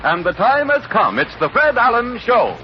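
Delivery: ordinary delivery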